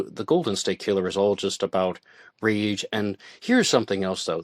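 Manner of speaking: ordinary talk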